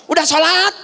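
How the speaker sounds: high, loud, impatient demand; mother snapping at her child; harsh mom. Retold or imitated. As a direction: fire the question straight off. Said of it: "Jadi langsung ngegas".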